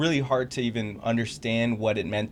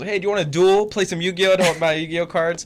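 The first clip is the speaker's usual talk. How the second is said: put-on higher, louder, keen kid asking to duel; retold eager challenge, higher and louder; eager invite; 6 semitones higher, 7 dB louder than usual. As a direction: play the eager homeschooler challenging a stranger. Retold himself inviting students to duel.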